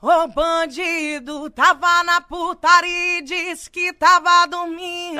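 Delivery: high, slow, stretched singer's little voice. Shown as a demonstration. Asked to demo, she does her signature little voice. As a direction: sing it in the cute little voice, drawn out. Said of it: "Faz como que é sem a voz?".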